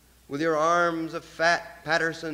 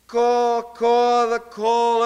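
higher, louder crow cry; high crow caw; 5 semitones higher, 5 dB louder, slower than usual. Acted. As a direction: let it fly up like a crow's call. Poet cries like a crow.

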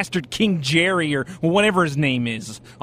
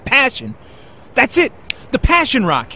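bursting out, higher and louder; sudden excitement; 8 semitones higher, 5 dB louder than usual. Acted. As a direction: blurt it out as it finally comes back. Remembering the name at last.

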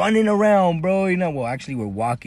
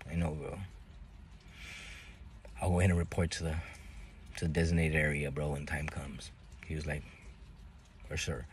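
resigned murmur; 10 semitones lower, 13 dB quieter than usual. Retold or imitated. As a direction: quietly accept the punishment. Resigned talk about coming beating.

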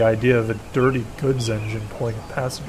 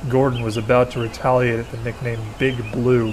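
usual speaking voice

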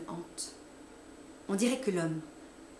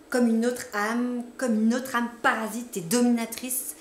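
dramatic emphasis; 4 semitones higher, 7 dB louder than usual. A narrator's reading.

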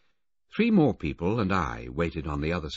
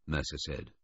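ordinary delivery

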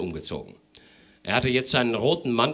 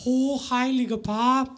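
timid hostess voice; slower than usual. Acted. Hostess helping out with lyrics.

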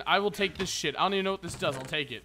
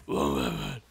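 Batman voice; slower than usual. Pretend. Low Batman voice.

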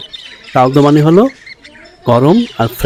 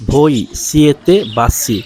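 very slow, each word laid out clearly; slow teacher demo; slow model reading; much slower than usual. Shown as a demonstration. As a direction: spell the sentence out slowly for learners. Teacher models Italian sentence.

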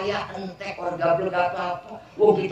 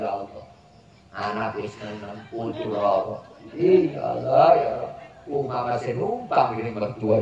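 lower, plaintive pleading to God; lamenting wife's prayer; 8 semitones lower than usual. Acted. Preacher voicing a poor wife begging God, lower and plaintive. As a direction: pray like a worn-out wife pouring out her troubles.

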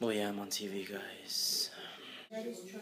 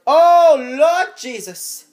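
loud, slow, much higher "Oh Lord Jesus"; disgusted cry; 12 semitones higher, 14 dB louder, much slower than usual. Real, not acted. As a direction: cry out to the heavens at the smell. Smells the dirty diaper.

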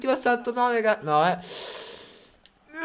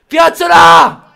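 long excited shout; 5 semitones higher, 12 dB louder, much slower than usual. Real, not acted. Watching the big chance, yelling the name drawn out. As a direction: drag the name out slowly at full volume.

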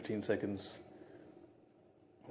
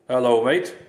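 robot voice changer; 3 semitones higher, 16 dB louder than usual. Real, not acted. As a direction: say it through a male robot filter. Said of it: "let's try again".

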